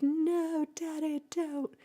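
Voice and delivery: put-on high, loud little-boy wail; child pleading. Pepe begging his daddy to stay, high and loud. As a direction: cry it out high like a scared kid. Pepe begs dad not go.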